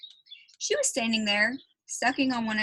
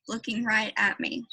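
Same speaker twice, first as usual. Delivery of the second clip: usual speaking voice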